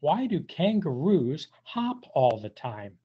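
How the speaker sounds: plain reading voice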